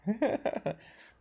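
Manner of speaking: high amused laugh; a quick high chuckle. Real, not acted. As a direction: burst out laughing at the game. Amused by Paimon's reaction.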